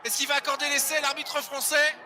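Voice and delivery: fast live rugby play-by-play; live sports caster. Real, not acted. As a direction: narrate the action as it unfolds. (commentateur sportif en direct)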